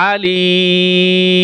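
আলিম with a very long, steady "ee" closing on m; reading with a held vowel. Shown as a demonstration. Teacher showing students the reading form, long drawn-out vowel. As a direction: stretch the second vowel of আলিম, then close on m. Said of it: "যখন আমরা reading পড়ব, তখন কি হবে?".

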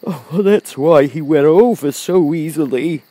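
high-pitched blustery lion voice; Cowardly Lion, realising. A narrator's reading. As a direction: remark in wonder as the lion. Lion remarks.

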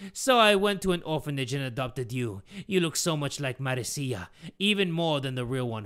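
put-on accented villain father confessing; hammed-up villain monologue; villainous Geppetto, cold. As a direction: reveal it without remorse.

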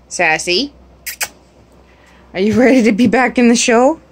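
ordinary talk, nothing marked

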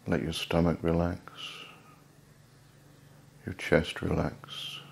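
soft, unhurried voice with long pauses; guided meditation. Real, not acted. Host guiding listeners to let each body part relax, soft meditation voice. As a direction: ease them into relaxation, softly, phrase by phrase.

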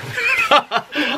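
high, short giggle; brief high laugh. Real, not acted. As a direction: giggle at yourself. Laughing at himself.

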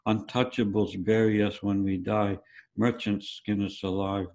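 plain reading voice

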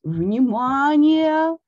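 long, slow, loud call for attention; booming announcement. A narrator's reading. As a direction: thunder it out to the hall. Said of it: "вновь прогремел голос".

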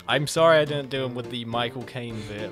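ordinary delivery

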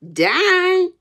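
loud, high exclamation; startled aunt's outcry; shocked question. A narrator's reading. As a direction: shriek it back in disbelief. Aunt hears Tom is dying.

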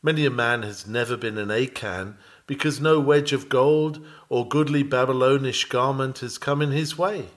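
ordinary delivery

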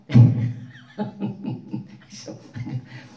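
helpless chuckling after a punchline; laughing. Real, not acted. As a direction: laugh at your own joke, trailing on. Laughing at his own punchline. (cười khúc khích)

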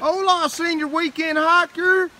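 high, loud, playful put-on Spanish accent; mock Spanish greeting. Greeting the weekend hiker on the trail, loud mock Spanish accent. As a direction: hail him like a cheesy Spanish host, in a mock Spanish accent.

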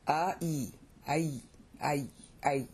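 "A, E" apart, then fused into "I"; diphthong demo. Shown as a demonstration. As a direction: blend A and E into one gliding I, repeated. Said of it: "you combined it".

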